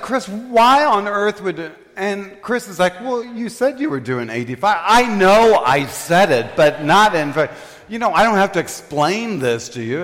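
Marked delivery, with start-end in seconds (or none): 0.0-1.7 high, slow exasperated demand; retold outrage at a friend; mad. Driver confronts friend after two tickets, mad. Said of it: "I was so mad at Chris". 3.0-4.8 high-pitched mock innocence; defensive friend. Friend defends himself to angry driver, high protest. 4.8-10.0 higher, insistent retort; retold argument, cutting himself off in frustration; exasperated. Driver argues back at friend, exasperated.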